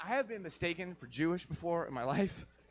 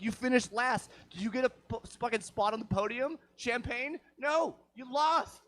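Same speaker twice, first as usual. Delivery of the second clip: mocking; 7 semitones higher than usual. Mocking the losing racer.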